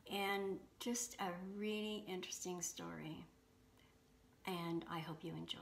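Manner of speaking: soft, even announcer read; hushed podcast intro narration; intro voiceover. Acted. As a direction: read the show intro quietly and smoothly. Host reads recorded intro.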